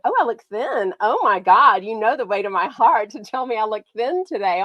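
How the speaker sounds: ordinary delivery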